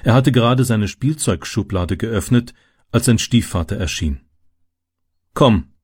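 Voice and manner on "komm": brief firm order; stepfather's curt command. A narrator's reading. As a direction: order the child along. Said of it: "befahl er".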